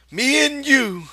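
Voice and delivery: put-on high and slow childlike voice; dreamy Lenny. A narrator's reading. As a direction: savour each word like a child dreaming. Lenny's voice in the story.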